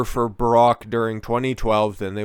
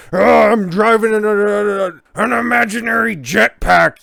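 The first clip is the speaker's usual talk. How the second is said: much higher, louder mock old-man rant; mocking Joe Biden; 10 semitones higher, 8 dB louder than usual. Host mocks Biden's rant for his viewers, high loud parody. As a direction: ham up a doddering president yelling about a jetpack. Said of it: "starts yelling and clenching his fists".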